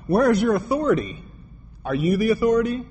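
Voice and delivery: high-pitched indignant questioning; retold challenge. Preacher recounts confronting the man over authority, challenging. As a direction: press him with pointed rhetorical questions.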